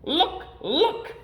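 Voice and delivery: high, urgent cry; panicked fish. A narrator's reading. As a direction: warn them in a fright. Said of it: "Then our fish said".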